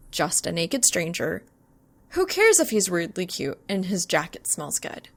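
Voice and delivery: usual speaking voice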